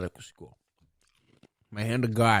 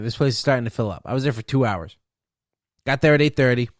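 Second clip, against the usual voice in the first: ordinary talk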